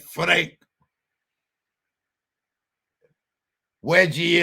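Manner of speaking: lightly hammed-up gangster warning; put-on mafia talk, stretched punchlines; mock mobster. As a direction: play a wiseguy confiding who to distrust. Mobster warns about a rival.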